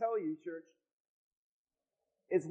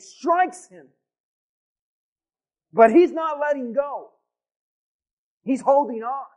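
preaching at full force; 5 dB louder than usual. Acted.

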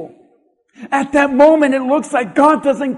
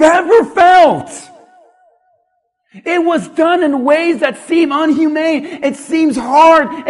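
rising, louder preacher's build; preaching at full force; 3 semitones higher, 4 dB louder than usual. Acted. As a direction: drive the pain home like a revival preacher. Preaching builds on surgery pain.

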